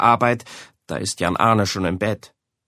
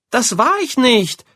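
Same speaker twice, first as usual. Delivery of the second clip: much higher, louder indignant denial; 13 semitones higher, 5 dB louder than usual. A narrator's reading. Boy protesting to his mother, indignant. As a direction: protest his innocence, outraged. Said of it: "ruft Jan Arne empört".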